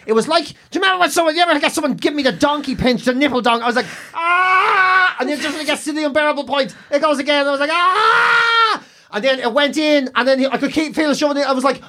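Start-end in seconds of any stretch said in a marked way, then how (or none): 4.1-5.2 scream of pain. Retold or imitated. Patient as catheter goes in, screaming in pain. 7.7-8.8 very high pained shout; scream of pain. Retold or imitated. Patient as catheter goes in, shrieking.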